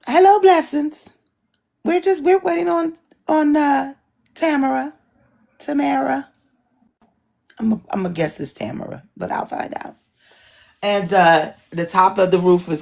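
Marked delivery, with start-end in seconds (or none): 0.1-6.3 much higher, sing-song hello; bright greeting voice. Real, not acted. Greeting viewers while waiting for Tamara, sing-song. 7.6-10.0 fast, softer guess; muttered aside. Real, not acted. Guessing the guest's name, muttered.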